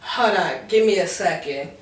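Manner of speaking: lightly voiced snippy girl on the phone; a hint of a rude drunk girl brushing him off; mocking her attitude. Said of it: "And so she goes".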